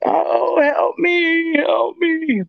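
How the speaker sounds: high, drawn-out cry for help; spirit's plea for help. Retold or imitated. Investigator mimics the voice caught in the room, high pleading cry. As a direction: imitate a captured voice pleading, high and sustained. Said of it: "I capture something ... that said".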